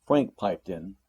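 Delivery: ordinary delivery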